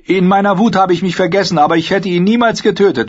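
high, loud earnest declaration; proud young woman. A narrator's reading. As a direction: stand tall and claim your oath. Narrator voices Brienne.

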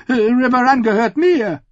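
very high, loud squeak of alarm. Acted. Lord Ammon squeaking in alarm at the council. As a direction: squeak out in shock.

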